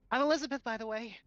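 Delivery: put-on high-pitched girl's voice; girl introducing herself. Game master as Elizabeth introducing herself, high girl voice. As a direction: lift the voice well up for a girl character. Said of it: "the girl kind of looks at you and is like".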